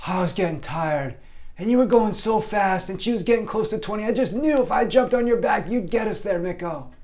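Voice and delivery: put-on high-pitched kid voice; pleading schoolboy. Boy explaining why he jumped on back, high pleading. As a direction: rush out a high, earnest excuse. Friend excuses jumping on back.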